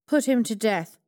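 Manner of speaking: loud, stern command; the king sentences Haman loudly; king's order. A narrator's reading. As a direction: hand down the sentence. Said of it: "the king ordered".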